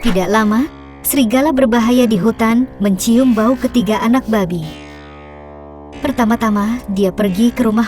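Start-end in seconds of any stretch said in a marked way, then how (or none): none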